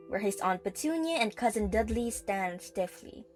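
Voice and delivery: usual speaking voice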